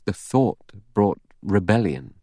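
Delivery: plain reading voice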